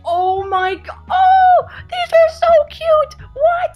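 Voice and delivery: high, loud delighted shriek; overjoyed fangirl squeal; ecstatic squeal. Real, not acted. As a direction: squeal at the top of your voice. Squeals at cute figures.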